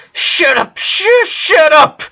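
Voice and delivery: high anguished scream, cracking on the repeat; yelled. Acted. Sister screaming at her dying brother to stop, anguished. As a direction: scream it into his chest. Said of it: "she yelled".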